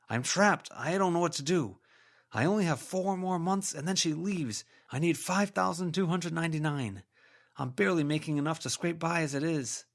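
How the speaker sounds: a trapped man begging for help; desperate ghost. A narrator's reading. Ghost telling Verona he is trapped, desperate.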